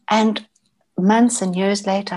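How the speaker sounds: usual speaking voice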